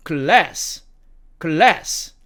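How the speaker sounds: American "glass", front a as in "cat"; American demo of "glass"; American accent. Shown as a demonstration. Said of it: "미국식 발음으로는".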